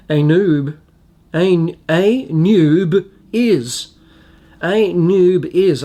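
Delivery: Anub is, very slowly as "ay... NYOOB... iz"; sounding it out. Shown as a demonstration. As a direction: break Anub is into slow, separate syllables. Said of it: "I got it right".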